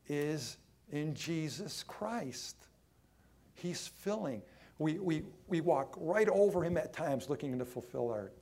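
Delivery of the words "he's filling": ordinary talk, nothing marked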